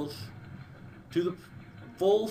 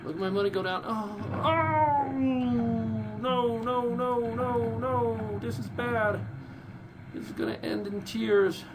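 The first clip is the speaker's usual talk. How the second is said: real dismay as the run collapses, voice pitched way up; panicked wail; 12 semitones higher than usual. Real, not acted. Gamer watching his fleet get wiped out, panicked high wail.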